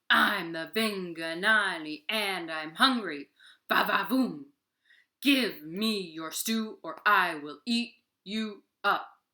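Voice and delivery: loud, slow monster growl demanding stew; booming hungry monster. Acted. Vinganani threatening Deer for his stew, loud slow monster voice. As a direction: boom it out like a greedy giant.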